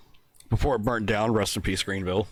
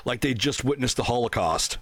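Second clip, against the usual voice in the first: normal talking voice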